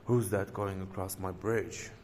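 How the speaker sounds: usual speaking voice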